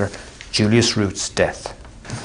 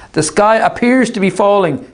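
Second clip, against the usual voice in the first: Artemis's voice; 10 semitones higher than usual. Acted. Artemis deluded, calling across.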